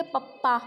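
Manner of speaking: पप्पा with a short first vowel and doubled p; mispronounced पापा. Shown as a demonstration. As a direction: say पापा wrongly as clipped-vowel "pap-pa", पप्पा. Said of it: "मैंने wrong बोला पप्पा". (पप्पा, छोटा अ और दोहरा प)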